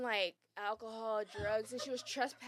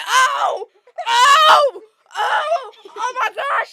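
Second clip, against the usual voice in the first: high, loud panicked yelps; friend screaming; 11 semitones higher, 18 dB louder, much slower than usual. Retold or imitated. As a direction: mimic a friend shrieking in panic. Friend screaming during fight.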